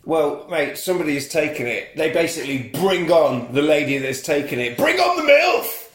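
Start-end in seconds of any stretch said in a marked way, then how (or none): 4.8-5.9 high, loud mock announcement; hammed-up high announcer call. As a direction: call it out like a showman. Mock announcer line in film retelling.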